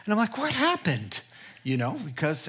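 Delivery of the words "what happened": high-pitched worried question; retold fretting, voice pitched up; anxious husband. Husband to his sad wife, anxious and high.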